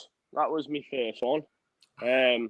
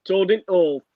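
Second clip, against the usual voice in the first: "Toad in hole" in broad Yorkshire, the "the" dropped, a bit higher and louder; broad Yorkshire accent; 5 semitones higher, 5 dB louder than usual. Shown as a demonstration. Asked by his co-host, says his favourite food in broad Yorkshire accent. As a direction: drop the article and lean into broad Yorkshire. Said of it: "if you said that in a Yorkshire accent".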